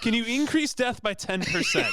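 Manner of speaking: laughing shout. Retold or imitated. Joking quote, laughing shout.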